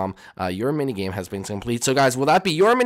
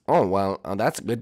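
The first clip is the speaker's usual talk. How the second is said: ordinary talk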